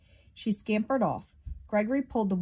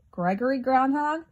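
high questioning call, rising at the end; rackety crow call; 6 semitones higher, 4 dB louder than usual. A narrator's reading. Charlie Crow calls to sleeping Gregory, rackety high call. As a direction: call out a noisy crow's question, pitched up. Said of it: "called in his rackety voice".

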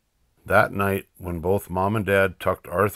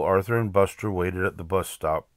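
ordinary delivery

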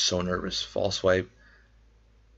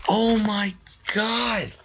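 high, stunned exclamations; overwhelmed with shock; excited disbelief; much slower than usual. Real, not acted. Continued shocked reaction.

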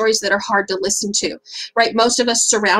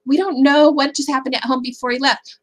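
ordinary talk, nothing marked

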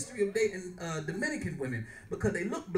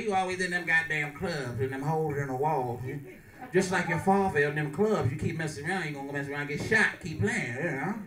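lightly voiced low-pitched mama; imitated scolding mother; 6 semitones lower than usual. Mother who hates her son doing comedy, mock scolding.

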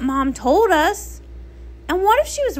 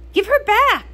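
desperate shout; 8 semitones higher, 4 dB louder than usual. Acted. Demanding her sister be returned.

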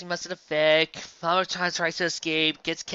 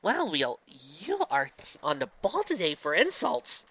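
ordinary talk, nothing marked

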